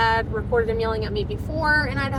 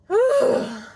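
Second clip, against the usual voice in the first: heavy sigh; 9 semitones higher, 4 dB louder than usual. Real, not acted. Exhausted after retelling the fight.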